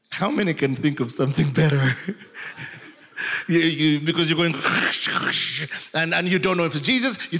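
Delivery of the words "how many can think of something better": ordinary talk, nothing marked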